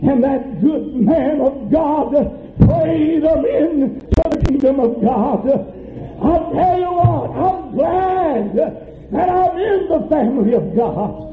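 high, slow, drawn-out preaching cry; fervent preaching. Real, not acted. Preacher testifying to church about a praying father, fervent preaching. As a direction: proclaim each phrase in rising testimony.

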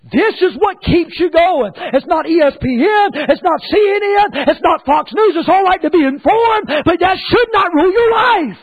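high, driving pulpit delivery; forceful preaching. Acted. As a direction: build to a high, emphatic finish.

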